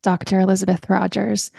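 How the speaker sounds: ordinary delivery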